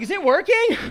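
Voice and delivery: high, breathless eager asking; mock eager. Friend at the bong, asking if it's working, high and eager. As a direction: squeak it out mouth on the bong. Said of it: "I was like".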